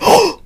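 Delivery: sharp high gasped "oh", acting out a fright; startled gasp. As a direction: imitate jolting awake with a very high gasp. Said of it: "I freak out".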